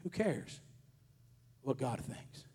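"Who cares what God thinks?" dropped low and soft, brushed off; scornful brush-off, low and quiet; dismissive. Shown as a demonstration. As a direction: shrug it off, falling and quiet. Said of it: "Or you could say".

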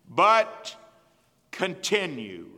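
But loud, then continue slow and falling; stressed key word. Shown as a demonstration. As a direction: land hard on continue. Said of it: "That's the word right there".

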